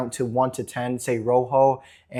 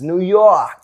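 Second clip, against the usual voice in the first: louder, higher mock New York accent; 4 semitones higher, 6 dB louder than usual. Shown as a demonstration. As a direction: put on a New Yorker's accent. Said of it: "There's an accent for New Yorkers".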